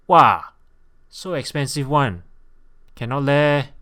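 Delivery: loud, high Singlish complaint; Singlish local. Acted. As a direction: protest the price in broad Singlish. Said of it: "A local might reply".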